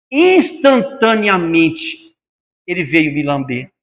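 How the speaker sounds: usual speaking voice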